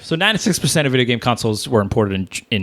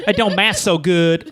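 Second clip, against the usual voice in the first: higher, mock Southern drawl; Southern accent; 7 semitones higher than usual. Host jokes about being bad at math, mock Southern accent. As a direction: put on a silly Southern accent. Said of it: "I always give it a Southern accent".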